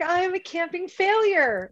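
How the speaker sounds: mock dramatic despair. Texting her best friend about the dead stove, mock despair. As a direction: play it as a mock tragedy. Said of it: "I'm like".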